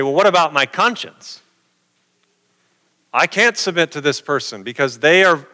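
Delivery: ordinary talk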